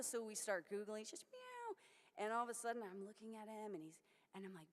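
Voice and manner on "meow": high, soft falling meow; kitten meow. Retold or imitated. Imitating the kitten to the audience, high soft meow. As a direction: mimic a tiny kitten crying.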